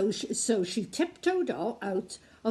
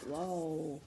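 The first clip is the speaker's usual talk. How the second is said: hushed whoa; 6 semitones lower, 9 dB quieter than usual. Real, not acted. Reader awed aside.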